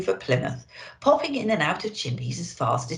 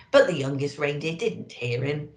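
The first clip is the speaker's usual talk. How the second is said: plain reading voice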